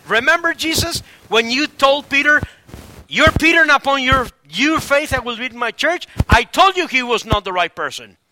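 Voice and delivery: loud, high, hammered accusation; indignant rebuke. Acted. Preacher imagining himself blaming Jesus for choosing Peter, indignant rebuke. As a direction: scold like a vindicated I-told-you-so.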